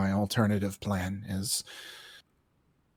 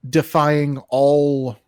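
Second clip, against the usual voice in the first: slow, loud epic build; dramatic buildup; 3 semitones higher, 7 dB louder, slower than usual. Real, not acted. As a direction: draw it out like a legend. Player pitching a dramatic Alamo story.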